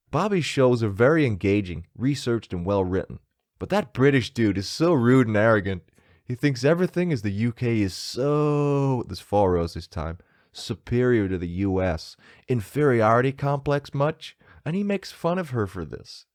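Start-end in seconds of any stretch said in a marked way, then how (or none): 0.1-9.0 put-on American accent, emphatic. Host reading out a review slagging him off, American accent. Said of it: "I'm gonna read this in an American accent". 10.5-16.3 put-on American accent, emphatic. Host reading out a review slagging him off, American accent. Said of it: "I'm gonna read this in an American accent".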